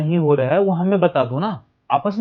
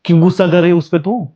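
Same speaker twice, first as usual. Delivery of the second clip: angry confrontation; 4 dB louder than usual. Acted. Husband confronts wife angrily.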